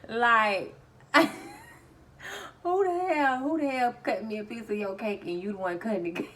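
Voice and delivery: loud high outburst between laughs; real laughing disbelief; tickled. Real, not acted. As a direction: laugh out the incredulous question, settling as it goes. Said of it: "it's still tickling me".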